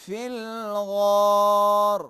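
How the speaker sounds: slow, drawn-out Quranic recitation. Shown as a demonstration. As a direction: chant it slowly with a throaty غ. Tajwid teacher demonstrating recitation.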